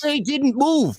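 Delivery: insisting hard that they didn't move; heated insistence. Real, not acted. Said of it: "This boy heated".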